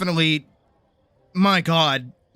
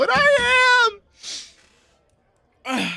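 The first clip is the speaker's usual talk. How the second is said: laughing while talking; slower than usual. Real, not acted. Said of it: "losing my mind".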